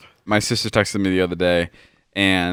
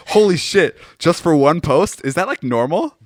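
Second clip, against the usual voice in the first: higher, louder shocked voice, slowing; mock amazement; 7 semitones higher, 5 dB louder than usual. Esther hearing the two-thousand-dollar rate, mock amazed. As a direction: gasp it out in disbelief. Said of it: "Esther goes".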